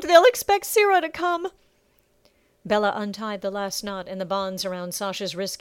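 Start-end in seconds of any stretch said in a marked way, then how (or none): 0.0-1.5 high, loud, tearful wail; sobbing. A narrator's reading. Circe warns the others, sobbing loudly. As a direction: keep it high and fearful. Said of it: "Circe sobbed".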